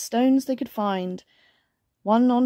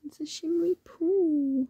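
high, soft, drawn-out coo; 7 semitones higher, 4 dB quieter than usual. Pretend. Reader admires the picture with listener, cooing. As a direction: admire it softly, pitched up high.

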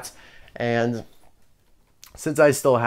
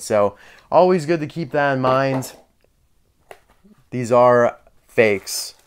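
ordinary talk

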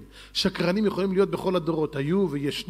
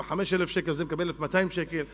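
ordinary talk

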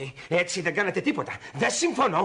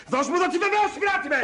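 high, heated order; angry demand; 9 semitones higher than usual. Acted. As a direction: demand it back, losing your temper. Demand escalates into a scuffle. (οργισμένη, ψηλόφωνη απαίτηση)

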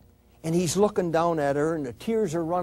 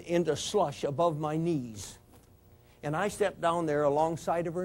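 usual speaking voice

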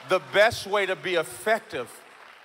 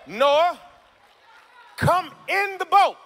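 high, slow, shouted preacher call; shouted, stretched; 12 semitones higher, 7 dB louder, slower than usual. Shown as a demonstration.

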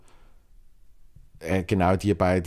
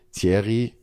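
usual speaking voice

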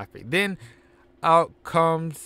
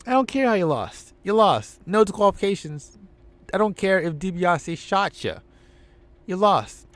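authority shout; 6 semitones higher than usual. Acted. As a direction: lay down the law. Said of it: "It's like".

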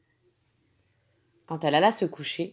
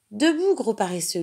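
put-on higher, louder cheerful girl voice; joyful wake-up call; 5 semitones higher, 5 dB louder than usual. Girl waking the lazy dog, joyful and higher. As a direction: wake him up teasingly, with joy. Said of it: "lui dit-elle joyeusement".

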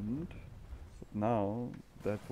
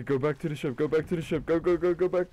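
building from firm orders to a high, loud yell; real panicked commands, climbing; urgent shout; 6 semitones higher, 7 dB louder, faster than usual. Real, not acted. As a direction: order the crewmate back, getting louder each time. Urgent orders to crewmate mid-fight.

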